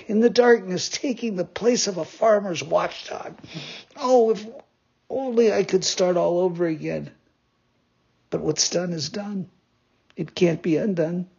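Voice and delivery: a touch of weak, sorry self-reproach. Acted. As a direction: puppet mildly crying out to himself. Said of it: "cried out in a weak voice".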